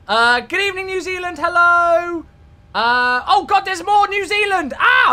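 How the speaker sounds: high, loud, delighted hello; excited greeting. Real, not acted. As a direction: greet them like a long-lost friend.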